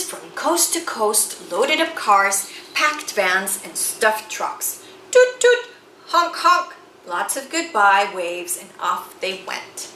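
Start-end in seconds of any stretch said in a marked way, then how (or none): 5.1-6.7 higher, louder horn imitation; car horns. The caravans leave, horns voiced much higher and louder. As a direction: toot and honk like the cars.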